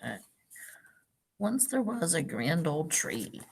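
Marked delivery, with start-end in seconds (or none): 1.4-3.4 gentle storybook read-aloud; picture-book narration for a class; reading to children. Acted. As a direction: read it like story time for little kids.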